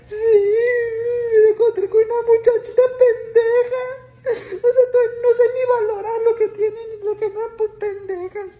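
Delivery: hammed-up weepy lament; mock crying lady. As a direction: drag it out, sobbing like a jilted señora. Lady crying over her boyfriend.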